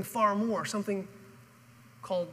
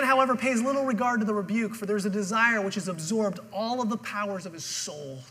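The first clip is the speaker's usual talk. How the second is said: ordinary talk, nothing marked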